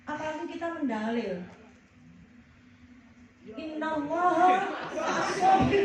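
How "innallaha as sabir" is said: mock preachy quote. Mock preaching a religious verse.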